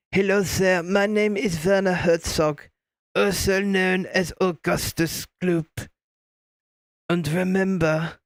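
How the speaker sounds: put-on slow German-accented English; measured German accent, word by word; Werner Herzog. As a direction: speak English with a deliberate, drawn-out German accent. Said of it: "English accent spoken in a German accent".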